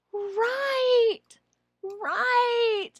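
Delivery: high encouraging prompt; coaching prompt. Shown as a demonstration. Trainer coaching a confused evaluator, high and encouraging. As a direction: coax the student along. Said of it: "hold your mouse up and go".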